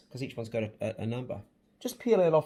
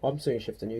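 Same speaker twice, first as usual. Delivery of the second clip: soft reassurance; 5 semitones lower, 5 dB quieter than usual. Real, not acted. Tender encouragement.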